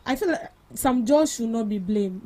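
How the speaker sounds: normal talking voice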